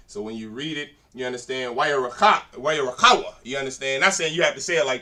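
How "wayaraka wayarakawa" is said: Wayarakawa punched out loud; hardcore emphasis. Shown as a demonstration. Teacher showing students how to read Hebrew, forceful emphasis on Wayarakawa. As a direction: hit Wayarakawa hard and forceful, especially the KA. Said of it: "make sure you emphasize those things".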